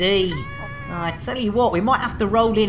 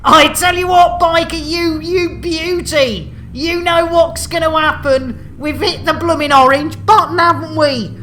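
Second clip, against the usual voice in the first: high, loud rallying cry; excited exclaiming; 6 semitones higher, 5 dB louder than usual. Pretend. Host telling Biker they've hit the orange button, excited. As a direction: hail your co-pilot at raised pitch.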